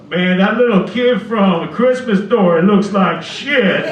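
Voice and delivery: slow, loud heckle; loud heckler. Retold or imitated. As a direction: holler it out from the back of the room. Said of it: "loud guy in the back".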